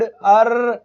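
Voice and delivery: अर्र said loud and held; ar sound demo. Shown as a demonstration. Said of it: "मैंने कहा था ना बर्ड". (अर्र ज़ोर से खींचकर)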